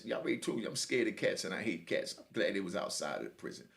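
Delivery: usual speaking voice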